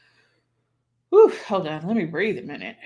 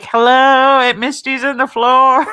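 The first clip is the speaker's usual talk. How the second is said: calling out much louder, laughing; hollering; 6 semitones higher, 11 dB louder, slower than usual. Retold or imitated. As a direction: holler from the floor for help. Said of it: "I'd just start hollering".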